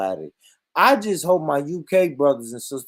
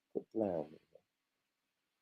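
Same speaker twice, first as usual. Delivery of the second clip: softer mumble, lower, almost to himself; muttered under breath; 10 semitones lower, 18 dB quieter, slower than usual. Real, not acted. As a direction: mutter it low before repeating. Muttered take before loud repeat.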